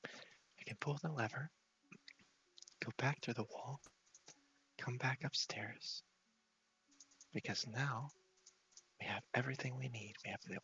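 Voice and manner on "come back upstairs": normal talking voice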